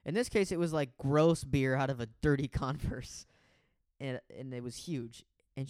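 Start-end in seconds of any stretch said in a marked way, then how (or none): none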